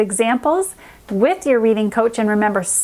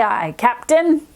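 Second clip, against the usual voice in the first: usual speaking voice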